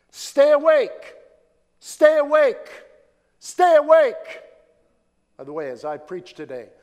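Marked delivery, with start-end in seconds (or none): 0.1-4.4 high, loud preacher's warning, drawn out; urgent cry. Real, not acted. Preacher warning the church to be ready, urgent high shout. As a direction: call it out slowly to rouse the sleepers.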